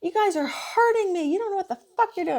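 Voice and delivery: a high, enraged retold rant; imitating angry yelling patient. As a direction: play the furious patient accusing her. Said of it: "he was started fucking yelling at me".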